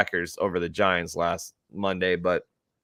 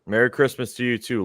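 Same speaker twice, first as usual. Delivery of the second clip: usual speaking voice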